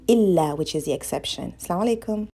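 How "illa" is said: "Illa" with a long double l, falling; correct Arabic. Shown as a demonstration. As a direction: say "Illa" holding the ll. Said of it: "but you're saying".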